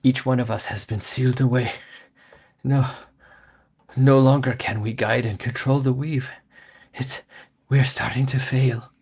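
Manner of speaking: ancient deity voice. Acted. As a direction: speak as a failing ancient power. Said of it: "he says".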